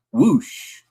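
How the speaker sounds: high rising whoosh; quick airy whoosh lifting up at the end; imitated whoosh. Host mimicking caller's three things to say, high whoosh.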